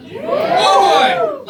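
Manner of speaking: very high, loud whoop; excited whoop. Real, not acted. Comedian whooping with the crowd over smoothies. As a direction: burst out in glee.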